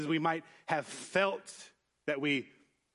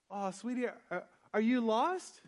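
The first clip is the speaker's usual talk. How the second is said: put-on higher, slowed question to a lost child; lightly voiced sweet woman leaning down to a child; concerned stranger; 7 semitones higher than usual. Woman to a lost little boy, gently concerned. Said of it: "she leans down, and she says".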